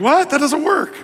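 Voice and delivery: baffled, half-laughing objection; incredulous. Retold or imitated. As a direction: voice leaps high in disbelief. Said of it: "You're like".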